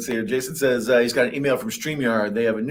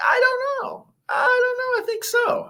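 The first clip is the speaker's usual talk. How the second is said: high, wailing mock cry; high put-on crying whine; whiny cry. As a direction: wail it like a whiny kid begging for a nap. Whining about tacos and naps.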